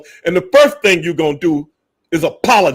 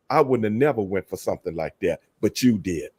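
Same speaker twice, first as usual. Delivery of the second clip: voicing Swerve's point; 10 semitones lower, 9 dB quieter than usual. Host paraphrasing Swerve to his co-host, low and soft. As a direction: lower and softer, lightly voicing Swerve. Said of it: "What Swerve is saying is".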